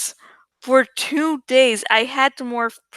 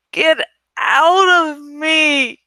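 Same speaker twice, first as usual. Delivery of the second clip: higher, louder, slow anguished scream; sudden scream; 4 semitones higher, 5 dB louder, slower than usual. A narrator's reading. Teacher screaming at the Yeerk inside him, anguished. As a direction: wrench it out in agony. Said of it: "Mister Perdue screamed suddenly".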